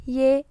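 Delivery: second "Y" said as "i-grek"; French letter Y. Shown as a demonstration. Teacher showing how Y is read, French letter name.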